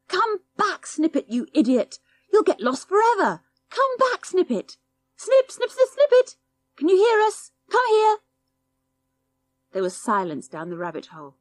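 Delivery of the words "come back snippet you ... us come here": much higher calls down a burrow; frantic yelling. A narrator's reading. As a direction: call the lost dog down the rabbit hole. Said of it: "The twins bent down by the hole and yelled".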